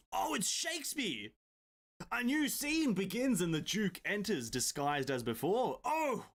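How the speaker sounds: high, delighted surprise; surprised. Real, not acted. Streamer surprised the game turns into Shakespeare, high exclamation. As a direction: yelp at the Shakespeare twist.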